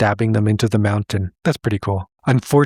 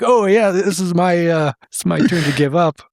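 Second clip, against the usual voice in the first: higher-pitched, lightly acted excuse; mock eager quitting; 6 semitones higher than usual. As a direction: play a bear happy to bow out. Bears happily quitting, acted.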